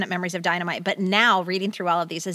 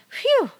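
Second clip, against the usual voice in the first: mock phew. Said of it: "Yeah, W H E W".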